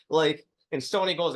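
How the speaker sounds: ordinary delivery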